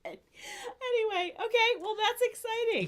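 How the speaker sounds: laugh-crying as she tries to move on; real helpless laughter. Real, not acted. Laughing, recovering.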